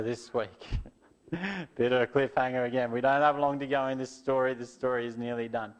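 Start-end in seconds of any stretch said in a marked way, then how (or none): none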